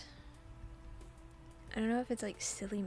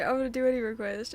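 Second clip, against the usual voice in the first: loud quote; 8 dB louder, much faster than usual. Retold or imitated. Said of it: "was like".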